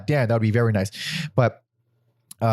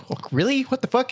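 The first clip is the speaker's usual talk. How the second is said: high, incredulous "oh really"; mock surprise; 12 semitones higher than usual. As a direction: act shocked and baffled. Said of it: "which is like".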